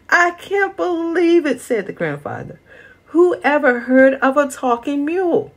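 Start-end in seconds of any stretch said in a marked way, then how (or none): 0.1-1.6 stunned disbelief. Acted. Stunned after mule talks. 3.1-5.5 stunned disbelief. Acted. Stunned after mule talks.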